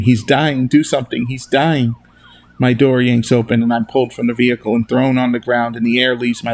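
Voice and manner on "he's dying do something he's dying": plain reading voice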